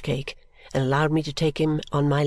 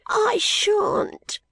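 put-on much higher defiant little boy; small boy. A narrator's reading. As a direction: refuse like a stubborn small child. Child Peepy voiced.

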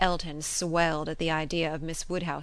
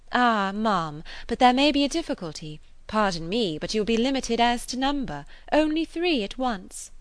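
raised, over-polite teasing; mock ceremony; 6 semitones higher, 6 dB louder than usual. A narrator's reading. Emma teasing Miss Bates, mock ceremony. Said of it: "the mock ceremony of her manner".

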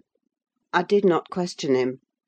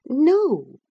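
high, firm refusal; half angry, half laughing; 9 semitones higher, 6 dB louder than usual. A narrator's reading. Wife refuses husband's kitchen suggestion, high droll refusal. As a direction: refuse him high and loud. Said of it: "half angry, half laughing at his fastidiousness".

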